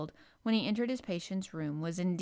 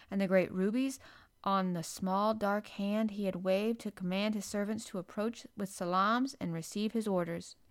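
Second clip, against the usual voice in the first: plain reading voice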